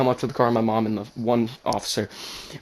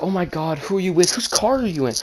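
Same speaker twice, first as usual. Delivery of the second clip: higher-pitched, urgent questioning; imitated alarmed mom; 7 semitones higher than usual. Mom asks whose car he's in, imitated alarm. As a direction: fire questions like an alarmed mother. Mom interrogates son on phone.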